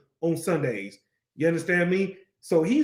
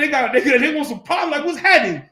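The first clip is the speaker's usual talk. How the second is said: higher and louder mock confrontation; street-slang challenge; 6 semitones higher, 5 dB louder than usual. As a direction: step up as if looking for a fight. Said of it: "So how we would say it is".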